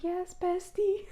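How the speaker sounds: high, drawn-out mock gushing; playfully overdone chummy agreement; gushing bestie. Gushing reply to friendly agent.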